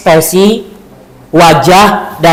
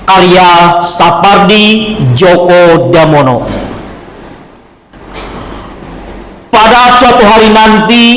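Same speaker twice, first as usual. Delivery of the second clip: poetry declamation. Shown as a demonstration. Teacher opening the chain poem recital in class. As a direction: declaim it with intonation and expression. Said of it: "Bagaimana cara membaca puisi dengan intonasi".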